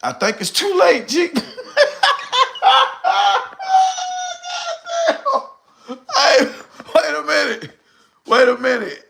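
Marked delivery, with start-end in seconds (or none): 1.3-5.5 big high whooping laugh. Real, not acted. Laughing at himself over vapes.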